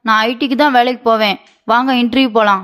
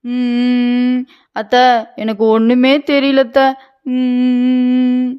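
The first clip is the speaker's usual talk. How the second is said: sulky whimper; 2 semitones higher, much slower than usual. Acted. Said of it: "sir, அழுகாத sir".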